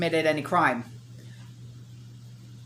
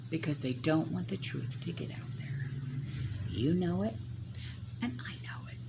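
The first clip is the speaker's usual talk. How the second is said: hushed; 2 semitones lower, 12 dB quieter than usual. Real, not acted. Confiding the truth.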